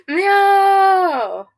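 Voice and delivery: high, long falling meow. Pretend. Cat owner imitating the purring cat, high meow.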